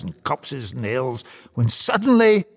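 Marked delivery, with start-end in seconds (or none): 1.5-2.4 raised, louder narrator's alarm; dramatic climax. A narrator's reading. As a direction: build high and loud to a sudden halt. Story builds to sudden halt.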